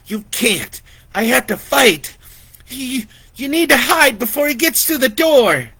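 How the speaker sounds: high, loud, pressing; urgent insistence. Acted. Vicky insists Lori hide, loud and urgent. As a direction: make her go hide.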